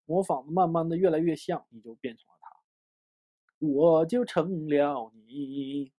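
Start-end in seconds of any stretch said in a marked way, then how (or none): 3.6-5.9 slow, stretched words; playfully drawn out. Pretend. Teacher joking about imitating until identical, playfully drawn out. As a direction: drag each word out in playful imitation.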